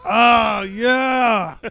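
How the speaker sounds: real whoop of approval; excited cheer. Real, not acted. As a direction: join in the celebration, stretching it out. Co-player joins the cheer.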